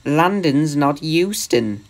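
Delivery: plain reading voice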